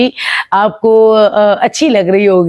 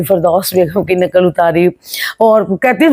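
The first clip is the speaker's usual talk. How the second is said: normal talking voice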